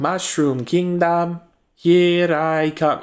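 slow Mario line with a weird inflection; drawn-out, oddly inflected hero line; Chris Pratt's Mario. Retold or imitated. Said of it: "what's he say in the trailer".

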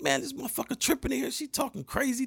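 high, fast exasperated complaint; telling his friend she is tripping, high and fast; high complaining voice. Retold or imitated. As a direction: rattle it off high and annoyed. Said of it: "I'm like".

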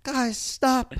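high, sweet peacemaker voice; sweetheart voice. Acted. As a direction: plead sweetly like a gentle hero. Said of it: "I'm the sweetheart".

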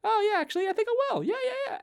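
very high, eager imitation; won-over enthusiasm. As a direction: agree eagerly in a squeaky put-on voice. Acts out woman being won over.

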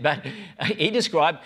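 usual speaking voice

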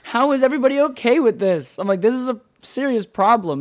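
high, loud, alarmed questioning; outraged exclaim. Retold or imitated. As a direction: demand answers, appalled. Retold outrage at homeless scene.

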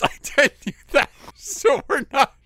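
high, loud, dissolving into laughter; laughing excuse. Retold or imitated. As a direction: barely get it out for laughing. Excuse to teacher, cracking up.

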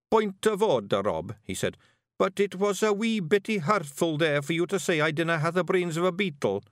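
Daft Wullie's voice. Acted.

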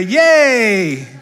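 high, laughing yay; playful shout of yay; cheerful yay. Shown as a demonstration. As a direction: model a joyful cheer for the room. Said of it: "Slap somebody else's hand that's up and say".

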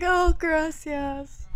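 put-on a slow, high "Oh, gracias" in a sweet gringa accent; drawn-out gracias. Thanking the mango vendor, slow high and sweet.

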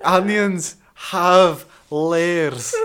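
put-on very slow, loud Scottish accent; hammed-up Scottish, stretching every word; high, booming mock-Scottish. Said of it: "Scottish accent, go".

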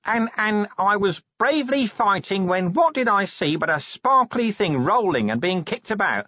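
high, halting then rushed babble; flustered, evasive Nobby. Acted. Nobby invents an account for Vimes, flustered and high. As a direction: make it up as you go, high-voiced.

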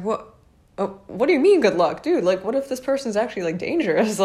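high, quick indignant comeback; indignant disbelief. Retold or imitated. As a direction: argue back, stunned and affronted. Argues back at the dismissive cop.

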